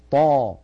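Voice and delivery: "طا" firm and long, no air after the ط; breath held ṭa. Shown as a demonstration. Teacher modelling the pure letter, "طا" without any puff. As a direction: hold the breath back on "طا". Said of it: "لا بد من حبس نفسها".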